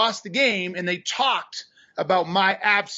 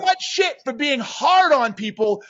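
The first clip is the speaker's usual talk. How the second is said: loud, high, emphatic rant; real indignation, hammering the key words; fired up; 7 semitones higher, 4 dB louder than usual. Real, not acted. Coach venting about being blamed for calling people out, fired up. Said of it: "I got fired up".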